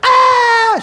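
high, loud startled scream. Retold or imitated. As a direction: shriek in shock as the dead appear. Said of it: "You're gonna get a scream out of them".

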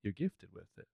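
put-on soft, low-key Southern accent. Teasing a friend about accents, soft Southern accent. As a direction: quietly tease in a Southern accent. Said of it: "a Southern accent's a work of beauty".

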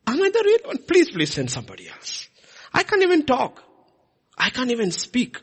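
high, pleading voice of a reluctant Moses; whiny pleading Moses. Retold or imitated. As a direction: beg to be let off, high and pleading.

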